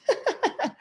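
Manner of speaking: high burst of laughter; a sudden real giggle at the cat. Real, not acted. Teacher laughs as her cat seeks attention, high giggle.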